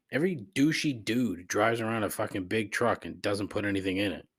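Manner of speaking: ordinary talk, nothing marked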